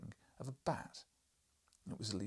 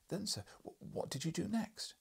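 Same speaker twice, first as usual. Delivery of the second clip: eager questioning journalist; 9 semitones higher than usual. Acted.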